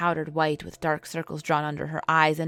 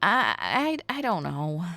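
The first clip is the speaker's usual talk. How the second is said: stammering. Acted. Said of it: "I stammered".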